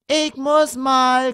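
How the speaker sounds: put-on high, loud, drawn-out child's whine; whining son. A narrator's reading. Son whining to his parents in the car. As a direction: whine it slowly like a pestering little boy. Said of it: "quengelte der Sohn".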